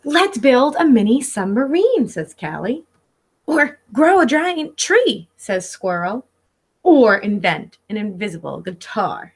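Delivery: lively picture-book read-aloud; reading to children. Acted. Teacher reading Pete the Cat to her class, storybook voice. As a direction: read it like story time for little kids.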